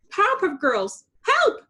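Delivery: high, loud desperate cry; crying for help. A narrator's reading. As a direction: scream for rescue. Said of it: "she cried".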